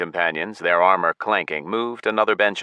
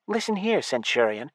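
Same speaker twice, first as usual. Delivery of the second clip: put-on higher young voice; elf girl; 8 semitones higher, 4 dB quieter than usual. A narrator's reading. Elf girl addressing the centurion, higher voice. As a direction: try to sound firm.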